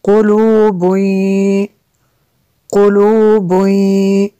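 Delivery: slow chanted "कुलूबुई" ending in a held nasal y; ghunnah with merging. Shown as a demonstration. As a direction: hum through the drawn-out end of "कुलूबुई". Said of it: "इसलिए हमने गुन्ना किया".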